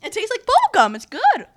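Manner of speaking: high bright delighted burst; excited. Real, not acted. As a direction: gush about the flavor. Excited first taste of bean.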